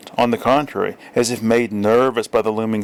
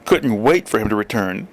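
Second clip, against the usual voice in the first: ordinary delivery, nothing marked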